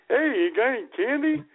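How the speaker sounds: mock customer. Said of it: "parents quoted at drive-through".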